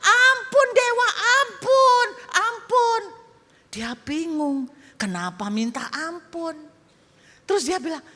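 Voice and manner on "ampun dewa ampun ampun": high, loud frightened pleading; much higher, louder villagers kneeling and begging for mercy; begging shout. Acted. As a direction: cry for mercy in terror. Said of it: "Dan mereka teriak".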